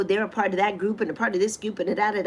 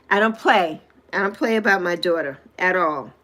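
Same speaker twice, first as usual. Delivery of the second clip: ordinary talk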